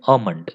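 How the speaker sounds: ఆమండ్ with the L dropped; silent L. Shown as a demonstration. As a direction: say ఆమండ్, no L before M. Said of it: "ఎల్ అనేది silent ఇక్కడ".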